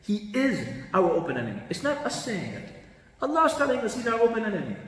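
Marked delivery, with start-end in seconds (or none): none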